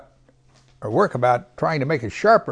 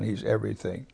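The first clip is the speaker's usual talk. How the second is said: usual speaking voice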